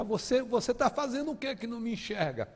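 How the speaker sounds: desperate high-pitched outcry; retold yelling at God. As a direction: shout your complaint up at the sky. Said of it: "começava a gritar".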